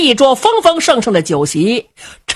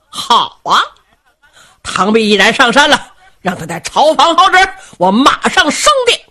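higher and louder, a boisterous carefree blusterer; brash jovial rogue; 4 semitones higher, 3 dB louder than usual. Acted. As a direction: boom it out like a merry rascal king who doesn't care. Cheng Yaojin voiced in story.

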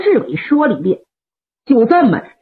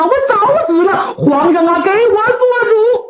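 high, sobbing plea, much higher; tearful complaint; 5 semitones higher than usual. Acted. Qilang weeps his case before the emperor, high sobbing plea. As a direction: pour out the grievance through tears. Wounded general weeping his grievance.

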